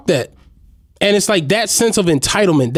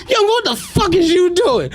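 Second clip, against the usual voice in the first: put-on high, cracking yell at the kid; incredulous yell; 10 semitones higher than usual. Driver catching kid in seat, high laughing yell.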